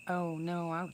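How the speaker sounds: usual speaking voice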